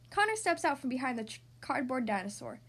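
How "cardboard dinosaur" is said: usual speaking voice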